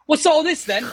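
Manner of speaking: high-pitched mock British accent. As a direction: re-enact a tipsy party-trick Cockney accent. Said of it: "I would talk in a British accent".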